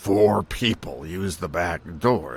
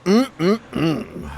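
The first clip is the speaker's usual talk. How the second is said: savoring hum; 12 semitones higher, 4 dB louder than usual. Acted. Savoring the burger.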